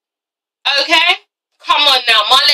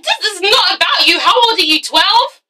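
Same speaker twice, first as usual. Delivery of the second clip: high-pitched mock-outraged scolding; outraged retort; 6 semitones higher than usual. Reviewer telling off a reality star for self-pity, outraged. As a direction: scold in a raised, incredulous pitch.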